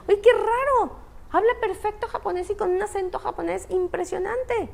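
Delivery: high-pitched mock amazement; astonished onlooker. People commenting on adopted child's Japanese, high-pitched astonishment.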